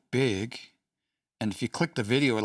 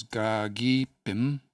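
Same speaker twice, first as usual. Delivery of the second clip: very slow demo; much slower than usual. Shown as a demonstration. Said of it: "teacher quotes the recording".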